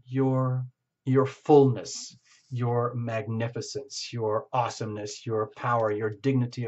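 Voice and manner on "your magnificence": usual speaking voice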